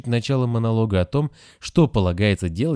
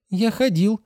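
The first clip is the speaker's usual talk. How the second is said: put-on much higher, puzzled child voice; surprised boy; 13 semitones higher than usual. A narrator's reading. Grasshopper answers the nurse, high and puzzled. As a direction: answer wide-eyed like a small boy. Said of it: "удивился кузнечик".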